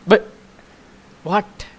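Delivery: sudden loud high "what?"; shocked outburst; surprised. Real, not acted. Shocked at game result.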